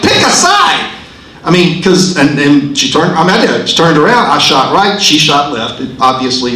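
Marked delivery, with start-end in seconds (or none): 0.0-0.9 shouted. Retold or imitated. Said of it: "and I'm like".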